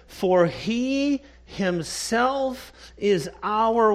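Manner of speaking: slow, stressed scripture reading; slow emphatic quote of he himself. Shown as a demonstration. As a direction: lean on he and himself, word by word. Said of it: "Notice the emphasis in that verse".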